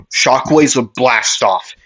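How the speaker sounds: ordinary delivery, nothing marked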